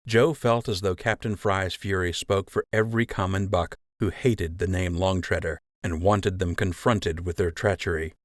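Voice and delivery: plain reading voice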